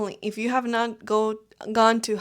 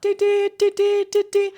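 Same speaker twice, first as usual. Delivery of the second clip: high, loud honking imitation; imitated car honks; 10 semitones higher, 5 dB louder than usual. Showing the constant honking in India, horn imitation. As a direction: mimic horns in high, even beeps. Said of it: "So you hear a lot".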